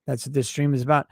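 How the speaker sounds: ordinary delivery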